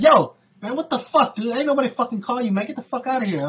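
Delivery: high-pitched retold outburst; annoyed. Diner tells the intruding dude to leave, annoyed. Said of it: "I got kinda annoyed".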